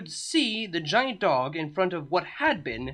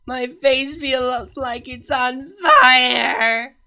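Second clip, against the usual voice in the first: slow, strained voice of a princess in pain; pained Cadance; 6 semitones higher, 7 dB louder, much slower than usual. A narrator's reading. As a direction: drag each word out through the hurt. Cadance's suffering voice.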